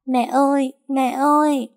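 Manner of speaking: put-on a little girl sobbing for her mother; small child crying out. Little daughter running to mother, wailing for her. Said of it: "gào to".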